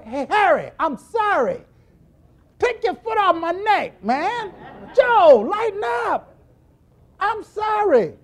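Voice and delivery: begging Harry and Joe to let up; mock pleading apology. Preacher pleads with friends to lighten up, high begging apology.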